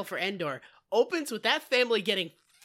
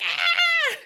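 very high comic shriek; mock agonized scream; 4 dB louder than usual. Mimicking an Ewok holding his guts, mock scream. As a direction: imitate a dying creature's wail. Imitates gutted Ewok's scream.

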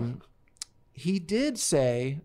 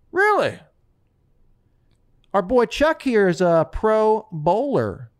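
loud announcement; 4 semitones higher, 6 dB louder than usual. Retold or imitated. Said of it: "Mike goes".

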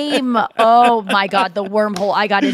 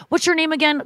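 higher-pitched innocent question; polite kid, pitched up; sincere young bellman; 6 semitones higher than usual. Retold or imitated. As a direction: lightly voice a sweet, earnest bellman. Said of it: "sincere bellman that was like".

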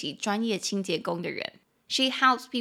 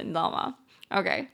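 ordinary talk, nothing marked

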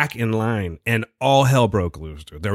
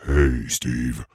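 very low, put-on greeting voice; put-on spooky voice; 13 semitones lower than usual. Imagined caller greeting Steve, hammed-up low voice. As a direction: drop way down into an exaggerated deep voice. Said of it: "the voice you just did".

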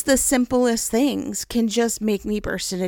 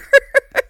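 loud laugh; 10 dB louder than usual. Real, not acted. Laughs at her shoe crisis.

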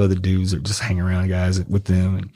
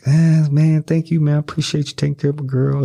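lightly voiced pleased guy saying thanks; lightly voiced thanks from the girl's man; grateful friend; 6 semitones higher than usual. Voicing Crunchy thanking him.

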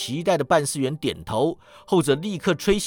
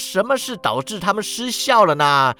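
mock relief; 7 semitones higher, 6 dB louder than usual. A narrator's reading. Scrooge sarcastic relief.

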